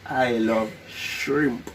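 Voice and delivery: very slow mouthing. Real, not acted. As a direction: stretch every word out.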